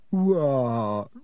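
put-on drawn-out, moody ghost girl; sulky. A narrator's reading. Glum ghost girl answers being called over, sulkily. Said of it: "she said sulkily".

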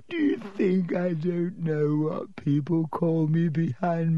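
slow, high, moaning self-pity; tearful wail.

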